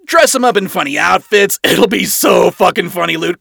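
loud, high, gleeful coaxing; loud cocky man voice. Acted. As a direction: sell the joke with glee. Adam coaxing Lute.

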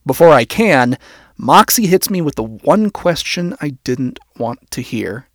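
ordinary delivery, nothing marked

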